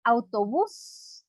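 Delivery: «Autobús» lento, subiendo en la última sílaba; acento final. Shown as a demonstration. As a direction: alarga el «bús» de «Autobús» para mostrar su acento. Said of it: "tiene su acento en la última sílaba". («Autobús» con la sílaba final acentuada y estirada)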